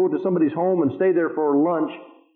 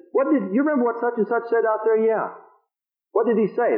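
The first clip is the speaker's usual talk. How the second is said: higher-pitched, coaxing parent questions; father coaxing child; 5 semitones higher than usual. Retold or imitated. Father asking his child on his lap what was said, coaxing. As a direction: lift the voice to question a little child.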